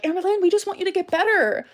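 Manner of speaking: high mocking whine of fake concern; mock sincere plea. Mocking her critics with a high fake-concerned plea. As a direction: parody the fake caring commenters.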